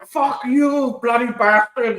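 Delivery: put-on high-pitched angry Indian accent; arguing Indian man. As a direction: squabble loudly in a hammed-up Indian accent. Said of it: "those two Indian dudes are, uh, going at it".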